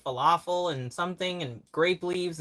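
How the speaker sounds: normal talking voice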